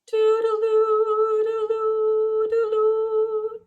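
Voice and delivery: high sung flute notes; flute tune sung. A narrator's reading. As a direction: sing it high like a little flute. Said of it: "he sang and played his flute".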